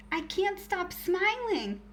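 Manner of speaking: a hammed-up childlike protest; angry yell. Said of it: "Alfie yelled angrily".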